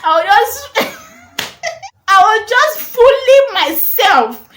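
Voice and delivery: high, helpless laughing voice; laughing at herself. Real, not acted. Storyteller recalling fooling herself, laughing hard. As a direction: squeal it out through laughter, loud. Laughing at her own silliness.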